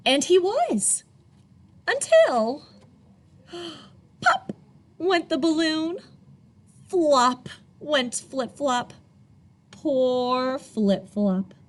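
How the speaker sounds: put-on lively storybook read-aloud for kids; animated children's story reading. A narrator's reading. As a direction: tell it like a picture book to little ones. Reads picture book to children.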